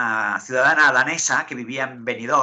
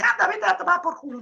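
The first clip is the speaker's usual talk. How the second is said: very high-pitched mock fury; imitated angry outburst. Annoyed interviewee to the laughing host, hammed-up high anger. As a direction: squeal it like someone losing patience. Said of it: "entonces ya se cabrea y me dice".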